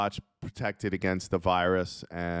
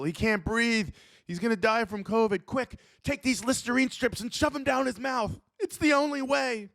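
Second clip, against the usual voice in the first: much higher, frantic doctor voice; panicked doctor; 14 semitones higher than usual. Pretend. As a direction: shout orders like a doctor in a panic. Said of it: "Imagine a doctor".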